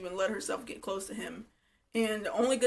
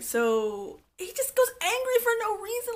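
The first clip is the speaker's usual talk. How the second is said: much higher, put-on voice; mock Robin; 12 semitones higher, 4 dB louder than usual. Imitating Robin at the reunion, high-pitched. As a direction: play up her puzzled tone. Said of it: "Robin was like".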